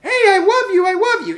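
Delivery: high, whiny, tearful I love you; mock clingy plea. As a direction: beg like a needy child. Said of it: "look at this".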